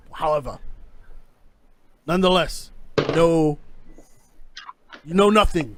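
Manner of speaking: lightly voiced pirate captain laying down terms; pirate captain bargaining. Captain offering the stowaway passage for silence, lightly voiced role. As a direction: state the deal firmly, as the captain. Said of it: "He, he goes".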